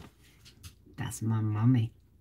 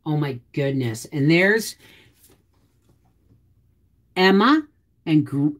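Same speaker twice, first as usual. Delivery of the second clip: ordinary delivery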